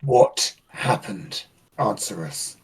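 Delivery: slow, low, deliberate demand; interrogator. Acted. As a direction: question the droid slowly in a deep voice. Acted interrogation of the droid.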